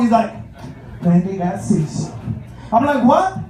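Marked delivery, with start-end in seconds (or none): 1.0-2.1 hammed-up soap opera reveal, lower pitch; melodramatic friend. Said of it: "he's very melodramatic". 3.0-3.5 high, loud baffled "what"; mock disbelief. Acted baffled reaction to friend.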